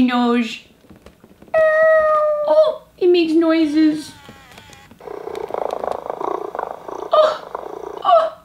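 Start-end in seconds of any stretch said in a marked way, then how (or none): 1.5-8.4 high-pitched cooing. Real, not acted. Cooing at the toy kitten.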